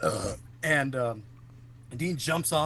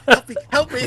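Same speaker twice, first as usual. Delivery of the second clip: high, loud plea broken by laughter; laughing mock plea; 11 semitones higher, 12 dB louder than usual. Retelling dad's prank while cracking up, laughing plea. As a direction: laugh through the fake cry for help. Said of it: "he goes".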